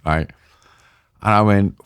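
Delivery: usual speaking voice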